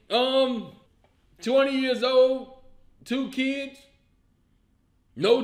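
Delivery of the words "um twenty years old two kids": ordinary talk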